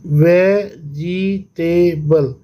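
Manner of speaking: very slow "vegetable" in four beats; syllable by syllable. Shown as a demonstration. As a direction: pause between वे, जि, टे and बल. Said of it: "syllable में divide करेंगे". ("Vegetable" चार हिस्सों में, धीरे-धीरे)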